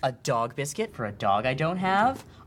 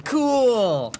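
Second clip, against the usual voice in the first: excited; 8 semitones higher, 4 dB louder than usual. Acted. Gushing over broken toy.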